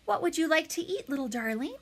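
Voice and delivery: friendly voice, starting high and easing down; friendliest voice. A narrator's reading. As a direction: coo it as if to a little one. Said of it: "using her friendliest voice".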